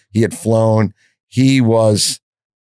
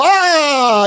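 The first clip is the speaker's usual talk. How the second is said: mock gasp. Guests react to cocaine.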